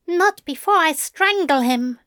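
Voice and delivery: high, huffy grumble; an angel muttering about strangling; grumbling threat. A narrator's reading. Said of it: "Aziraphale grumbled".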